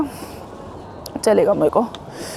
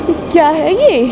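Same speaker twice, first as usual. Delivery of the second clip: sudden high-pitched puzzled question; surprised; 8 semitones higher, 5 dB louder than usual. Real, not acted. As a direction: caught off guard by a strange place. Lost at unknown metro station.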